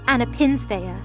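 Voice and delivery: ordinary talk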